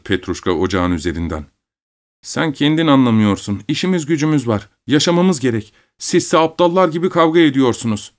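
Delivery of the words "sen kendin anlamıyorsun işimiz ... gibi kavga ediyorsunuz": put-on high-pitched child telling off grown-ups; young boy, lecturing. A narrator's reading. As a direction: scold your parents like a little old man. Son lectures quarrelling parents. (büyüklere akıl veren küçük oğlan)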